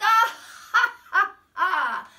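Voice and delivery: high, loud, hammed-up laugh; big playful mock laugh; dog's laugh. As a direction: laugh like a silly cartoon dog. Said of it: "The little dog laughed".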